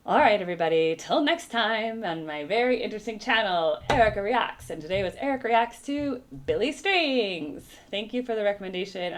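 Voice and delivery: loud, high outro announcer voice; bright cheerful YouTube host sign-off; upbeat channel sign-off. Acted. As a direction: sell the channel name like a host.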